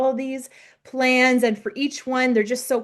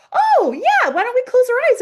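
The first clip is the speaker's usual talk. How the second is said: very high, louder, enthusiastic; gushing agreement; 13 semitones higher, 7 dB louder than usual. Retold or imitated. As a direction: eagerly go along. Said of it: "May's like".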